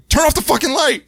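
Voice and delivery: high-pitched mock outburst; hammed-up annoyance; irritated Michael Myers. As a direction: squawk it like someone rudely disturbed. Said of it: "Mike's like".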